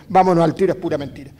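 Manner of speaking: loud burst, then it settles; loud retold exclamation. Old man quoting Violeta's loud exclamation, loud then settling. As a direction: blurt it out loud. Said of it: "me dijo la Violeta".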